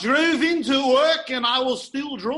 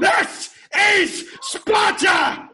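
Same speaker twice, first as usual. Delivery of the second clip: battle roar; 9 semitones higher, 9 dB louder, much slower than usual. Acted. Friends shouting movie impressions.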